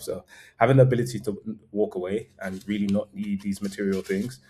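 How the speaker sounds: normal talking voice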